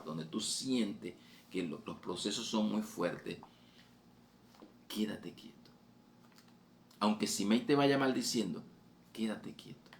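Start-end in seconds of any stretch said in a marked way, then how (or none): 4.9-5.6 whispered advice. Shown as a demonstration. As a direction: whisper it, unvoiced and hushed. Predicador susurra el consejo. (susurrado, casi sin voz)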